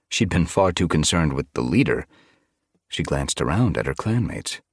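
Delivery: usual speaking voice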